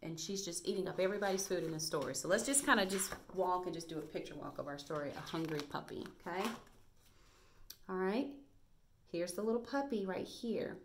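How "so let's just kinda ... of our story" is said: normal talking voice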